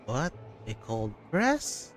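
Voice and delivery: ordinary delivery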